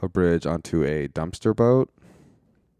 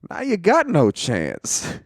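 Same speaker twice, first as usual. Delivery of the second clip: mock villain taunt; 11 semitones higher, 4 dB louder, slower than usual. Retelling a movie villain's threat.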